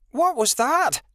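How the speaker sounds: high, alarmed shout; startled high-pitched demand. A narrator's reading. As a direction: cry out, pitched far up. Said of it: "Rebecca shouted".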